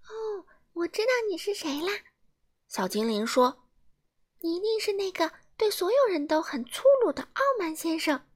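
high elf voice, teasing; a tiny elf who has him figured out; cheeky high fairy voice; knowing little elf. A narrator's reading.

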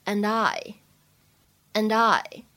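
"and I" joined as "an-dye"; slow, linked "and I", said as one word. Shown as a demonstration. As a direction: carry the d of "and" straight into "I". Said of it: "可以有一个连读".

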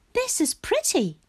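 high-pitched little frog; high, light character voice; pleased frog voice. A narrator's reading. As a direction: remark in a high storybook frog voice. Frog admires the view.

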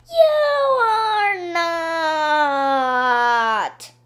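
loud, drawn-out protest, high then dropping; hollering. A narrator's reading. Kids contradicting Missus Roopy together, hollering. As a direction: holler it, stretching each word. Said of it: "we all hollered".

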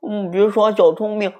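high mumbling through food. A narrator's reading. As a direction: mutter with a mouthful. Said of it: "妈妈也嘟嘟囔囔地说".

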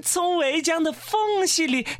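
high-pitched excited commentary; excited pointing-out. Acted. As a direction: call everyone over to watch the action. Animal host points out spectacle.

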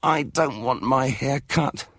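put-on high, slow, whiny boy; stubborn child. A narrator's reading. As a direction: drag out the refusal. Kipper refusing the haircut.

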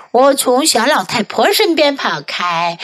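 ordinary delivery, nothing marked

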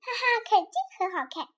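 quick, high gleeful voice; happy. Acted. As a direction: chuckle out a spoken haha in delight. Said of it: "莉莉开心的".

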